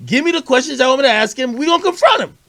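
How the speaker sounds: pitched high and eager; fired-up retort. Retold or imitated. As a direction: snap back, ready for a fight. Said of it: "So I said".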